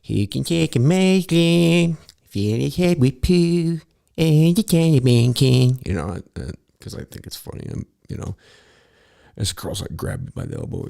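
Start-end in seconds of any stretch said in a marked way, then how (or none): 0.0-5.8 high, slow, loud bratty cartoon kid singing; Cartman voice. Joking in the security line, loud high Cartman singing. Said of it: "I start singing a song in my Cartman voice".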